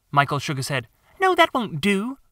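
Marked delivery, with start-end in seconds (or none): 1.2-2.2 put-on high boy voice, refusing; a stubborn little brother saying no; young boy. Michael refuses John's idea, high boy voice.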